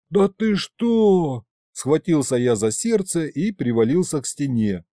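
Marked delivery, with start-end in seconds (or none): 0.1-1.4 high, drawn-out disbelief; shocked. A narrator's reading. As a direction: gasp the question out in horror. Shocked, clutches his heart. (потрясённо, высоко и протяжно)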